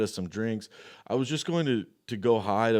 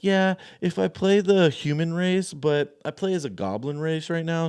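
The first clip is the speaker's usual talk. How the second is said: put-on high girly voice; 8 semitones higher, 4 dB louder than usual. Drunk girl quoted in story.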